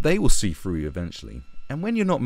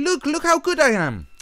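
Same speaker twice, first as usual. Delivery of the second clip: very high, whiny falsetto; squeaky mock outrage; mocking little-boy voice. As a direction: mimic a prissy, scolding little boy. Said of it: "little boys will do this kind of thing".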